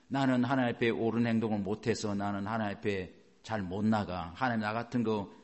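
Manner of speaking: low, subdued muttered self-doubt; self-condemning thought. Acted. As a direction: voice a defeated inner thought. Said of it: "이 생각이 딱 들어와요".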